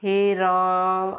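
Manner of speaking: very slow, stretching ओम and राम; prolonged calling tone on ओम and हे राम; drawn-out pluta vowels. Shown as a demonstration. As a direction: hold each vowel long, as if calling someone far away. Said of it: "किसी को दूर से बुलाने के लिए".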